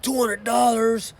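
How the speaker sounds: high, slow, imitating disbelief; hammed-up gasp at the price; mock shocked customer. Customer balking at the bill, mock disbelief. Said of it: "And she's like".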